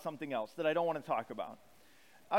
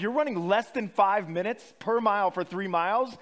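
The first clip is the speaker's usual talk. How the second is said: incredulous; 3 semitones higher, 7 dB louder than usual. Retold or imitated. Hearing an elite runner's time.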